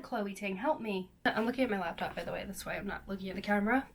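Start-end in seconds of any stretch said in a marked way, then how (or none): none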